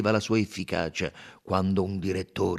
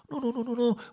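protesting. Acted. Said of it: "protestò Poirot".

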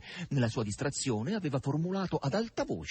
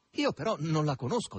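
short and dry, a bit louder; curtly; 5 semitones higher, 4 dB louder than usual. Acted. Margherita brushing off the stranger, curtly. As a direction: cut him off curtly. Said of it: "disse seccamente Margherita".

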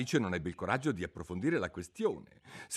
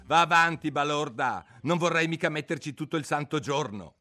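impatient griffin, higher and louder; gruff griffin; 6 semitones higher, 7 dB louder than usual. Acted. Griffin impatiently urging the turtle to get on with it.

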